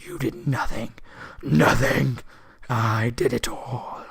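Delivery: slow whispered wizard menace; creepy drawn-out whisper, as a sinister old wizard; really creepy voice. Acted. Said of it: "the really creepy voice".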